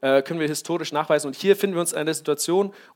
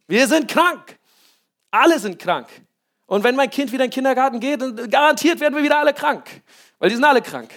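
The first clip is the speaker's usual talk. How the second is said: higher, complaining parent voice; mock whiny parent; 6 semitones higher, 3 dB louder than usual. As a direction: play the fretting parent who sees only facts. Mocking a worried parent's complaint. (gespielt jammernde Mutter)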